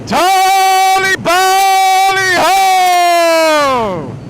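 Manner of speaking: very high, very loud, drawn-out posh cry; hammed-up posh cheer. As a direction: bellow it like a mock old English gentleman. Mock posh cheer while riding.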